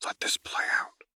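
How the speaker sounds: soft unvoiced whisper. A narrator's reading. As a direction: lean in and breathe it to her. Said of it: "took her hand and whispered".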